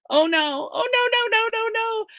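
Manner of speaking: high, rising panicked cries. A narrator's reading. Narrator thinking the bear eats Bridger, panicking. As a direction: wail it in horror.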